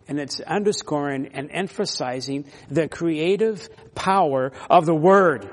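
normal talking voice

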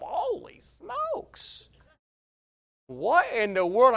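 high, slow stunned holy smokes; astonished thought. Retold or imitated. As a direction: gape at the crying crowd in disbelief. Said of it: "I'm thinking to myself".

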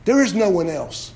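loud, high preaching cry; fiery preaching. Acted. Pastor urging the congregation, preaching loud and high. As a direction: preach it out with full force.

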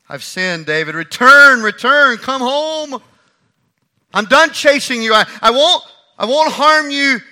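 loud, high call to come home; pleading call. Acted. Saul calling David home, loud and pleading. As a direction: beg him to return, loudly.

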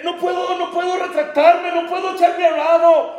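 high, straining plea to his father; pleading high and intense with a broken laugh; impassioned plea. Retold or imitated. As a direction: beg him to understand, voice pushed way up.

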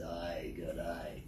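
low, soft gravelly Aussie drawl; imitated gravel-voiced Australian bushman; deep gravelly bushie. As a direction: growl it deep like an outback local. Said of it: "He's got a deep, gravelly voice".

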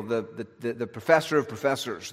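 usual speaking voice

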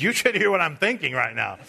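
usual speaking voice

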